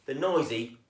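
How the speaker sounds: plain reading voice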